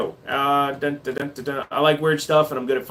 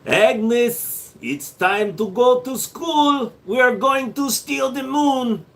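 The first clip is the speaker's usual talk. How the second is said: high, drawn-out mock Russian; Russian accent; 6 semitones higher, slower than usual. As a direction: ham it up as a scheming Russian. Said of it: "I'm done with the Russian".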